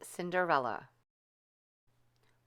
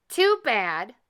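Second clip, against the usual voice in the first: louder mocking jeer; 5 semitones higher, 8 dB louder than usual. A narrator's reading. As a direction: gloat. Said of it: "said the stepsisters".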